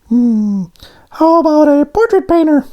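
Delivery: squeaky high cartoon voice; high donut voice. A narrator's reading. Voices Arnie.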